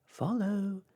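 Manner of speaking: high, loud call; cried out. Acted. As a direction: call them onward. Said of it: "cried the star".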